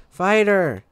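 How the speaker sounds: high sighing call; sigh, then call his name after him. Acted. Kid calls after the departing Fighter, high call.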